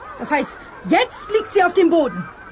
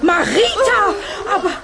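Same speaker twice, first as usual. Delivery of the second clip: shocked exclamation; 5 semitones higher than usual. Acted. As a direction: cry out in alarm. Teacher shocked by the jam.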